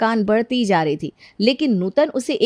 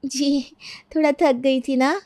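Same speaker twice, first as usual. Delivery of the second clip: higher-pitched, timid servant voice; meek excuse; 6 semitones higher, slower than usual. Acted. Maid caught resting by her mistress, meek excuse. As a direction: explain yourself apologetically.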